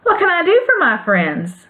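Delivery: high, squeaky mouse queen; squeaky little voice. Acted. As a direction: pipe it like a tiny gracious mouse. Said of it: "in her squeaky little voice".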